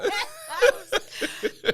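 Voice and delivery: high, bursting laugh. Real, not acted. Laughing at her own geography slip, high burst. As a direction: crack up helplessly.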